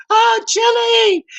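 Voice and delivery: very high, slow mock woman's cry from offstage. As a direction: stretch the name out in a high squeal like the girl. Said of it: "and I heard the girl".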